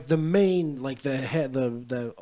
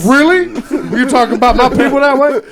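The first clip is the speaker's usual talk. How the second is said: high, loud and laughing mock outrage; mock indignation; 12 semitones higher, 10 dB louder than usual. Teasing a friend by voicing his offended reaction, mock-indignant. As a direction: act offended, voice pitched way up through laughter.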